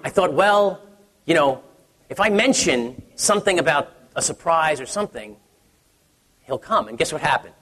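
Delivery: ordinary talk, nothing marked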